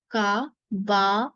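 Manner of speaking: काबान stretched very slowly, rising; slow demo. Shown as a demonstration. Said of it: "hiragana में होते हैं काबान". (काबान धीरे खींचकर)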